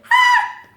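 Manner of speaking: very high, loud squeaked gasp; mouse's squeaky shocked "ha"; imitated mouse gasp. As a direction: gasp like a startled tiny mouse. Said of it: "and the mouse going".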